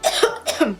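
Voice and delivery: a real cough, dry and short; coughing fit. Real, not acted. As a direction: cough once and clear your throat. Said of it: "I'm sorry if I'm coughing a lot".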